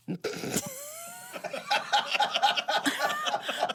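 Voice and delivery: shrieking laugh rising high; squealing laugh at the lost answers; high squeal of laughter. Retold or imitated. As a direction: let a high laugh burst out. Typed answers vanished, she reacts.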